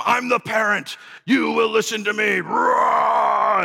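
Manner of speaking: hammed-up authoritarian growl; domineering parent. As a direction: bark it like a power-hungry parent.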